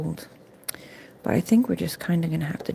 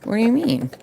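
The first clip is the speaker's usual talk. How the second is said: normal talking voice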